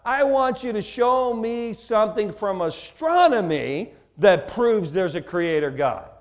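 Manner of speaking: ordinary talk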